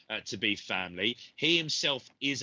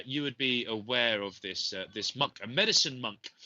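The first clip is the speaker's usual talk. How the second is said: normal talking voice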